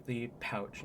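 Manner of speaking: normal talking voice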